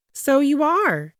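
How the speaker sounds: high-voiced fussing wife; worried wife. A narrator's reading. First Lady checking on her husband in the bath. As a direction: ask sweetly, faintly suspicious.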